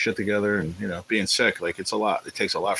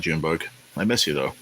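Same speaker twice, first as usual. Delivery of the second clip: usual speaking voice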